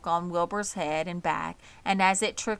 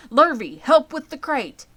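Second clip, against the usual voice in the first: loud, high hurried order; urgent order; 8 semitones higher, 10 dB louder than usual. A narrator's reading. Zuckerman orders his hired man to fetch the crate. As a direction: bark the order to hurry.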